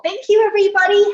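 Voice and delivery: high, quick, bright thank-you; cheery thanks. Real, not acted. Instructor thanking the class at the end, upbeat. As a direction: send the class off happily.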